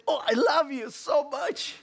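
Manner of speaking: high, hammed-up lovey voice; mock gushing affection. Husband to the wife he just punched, mock adoring.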